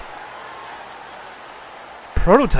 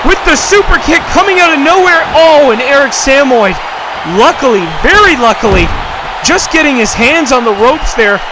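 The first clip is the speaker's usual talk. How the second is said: excited play-by-play; 6 semitones higher than usual. Acted. Commentator calling the near-falls and pin to viewers, screaming with excitement. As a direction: scream the finish as it happens. Wild finish of wrestling match.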